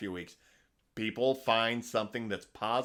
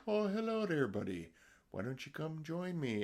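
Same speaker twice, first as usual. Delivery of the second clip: soothing Canadian woodsman; 6 semitones higher, 4 dB quieter than usual. Retold or imitated. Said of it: "speaks with a soothing voice".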